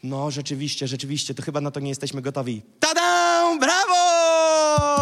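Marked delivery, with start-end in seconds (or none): 2.8-5.0 high, loud, long-held cheer; over-the-top triumphant shout; mock fanfare. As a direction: belt out a drawn-out mock bravo. Mock fanfare after doubting readiness.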